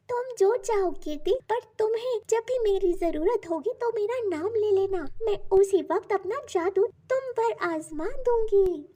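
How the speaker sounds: high storybook magic-creature voice; magical sandal's voice. A narrator's reading. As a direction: introduce yourself as a magical talking object. Magical sandal figure speaking.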